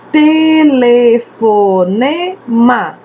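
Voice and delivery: TE-LE-FO-NE-MA, five slow beats; syllable by syllable. Shown as a demonstration. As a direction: say telefonema very slowly, every syllable held. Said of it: "São cinco sílabas".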